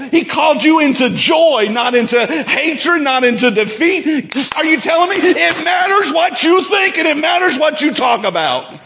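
fired-up preacher shouting to the church; riled-up preaching. Real, not acted. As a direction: preach it full force, climbing to a roar. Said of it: "I'm getting riled up now".

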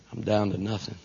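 low, very soft quote; quiet despair. Retold or imitated. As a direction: let it drop, defeated. Retelling woman's despairing words.

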